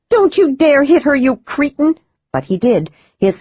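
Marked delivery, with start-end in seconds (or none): 0.1-1.9 yelling. Acted. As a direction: scream at him to stop.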